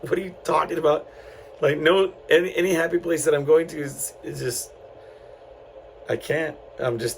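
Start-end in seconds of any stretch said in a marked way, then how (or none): 0.0-3.4 incredulous. Retold or imitated. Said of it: "and I'm like".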